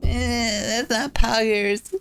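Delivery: put-on high, slow whiny kid; child voice. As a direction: drag it out like a sulky girl. Child voice complaining slowly.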